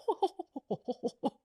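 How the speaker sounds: high ho-ho laugh; mock laugh. Speaker laughing at the men's standoff, hammed up. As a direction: laugh it off theatrically. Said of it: "I said".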